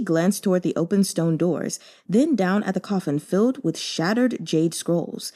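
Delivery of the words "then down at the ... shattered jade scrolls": ordinary delivery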